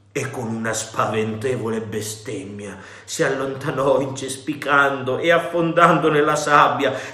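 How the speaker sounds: usual speaking voice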